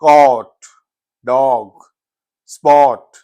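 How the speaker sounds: caught, spot with a long open aa, stressed; long American vowel. Shown as a demonstration. Teacher repeats the American long vowel in caught. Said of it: "that is a long vowel".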